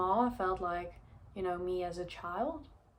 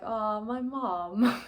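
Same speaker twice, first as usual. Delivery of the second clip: slow, tender sigh of a line; touched; 4 semitones higher, 8 dB louder, much slower than usual. Real, not acted. Remembering her mom's help, slow and tender. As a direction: let it melt out, slowly, a bit choked up.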